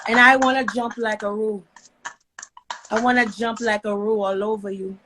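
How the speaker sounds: playful mock Aussie accent; Australian accent. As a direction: flirt in a joking Australian accent.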